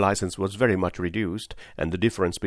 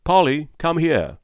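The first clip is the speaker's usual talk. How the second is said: loud, high call for his wife; husband shouting for Polly to come; shouted call; 8 semitones higher, 6 dB louder than usual. Acted. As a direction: call her over at full voice. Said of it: "he shouted".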